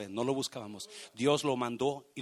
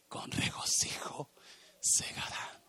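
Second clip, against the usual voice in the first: whisper. Acted. Psalm verse read softly.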